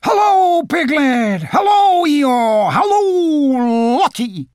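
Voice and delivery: very high, loud bouncy greeting; exuberant cry. A narrator's reading. Tigger greeting all his friends, exuberant high cry. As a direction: call out each hello with huge excitement. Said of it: "cried Tigger".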